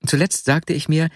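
usual speaking voice

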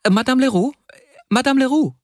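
high, questioning call. A narrator's reading. Customer calls for the shopkeeper, high calling-out. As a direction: call the name twice, pitched right up.

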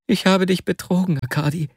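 high, distressed confession; anguished confession. Acted. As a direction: confess in anguish to your dearest friend. Tearful confession to his friend.

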